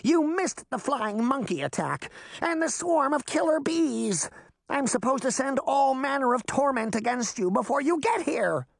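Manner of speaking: high, theatrical wicked-witch voice; a stagey witch complaining about her missed torments. Acted. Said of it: "she cried".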